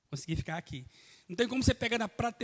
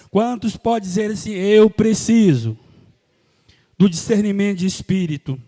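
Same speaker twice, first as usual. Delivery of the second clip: loud altar call; 3 semitones lower, 11 dB louder than usual. Real, not acted. Pastor calling the congregation to declare it aloud, loudly. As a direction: call the church to say it with you.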